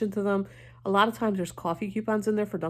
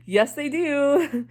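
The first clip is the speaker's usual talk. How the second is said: high, loud, slow laugh-through words; 8 semitones higher, 5 dB louder, much slower than usual. Real, not acted. Assuring viewers the company pays, laughing. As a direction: giggle out the words slowly.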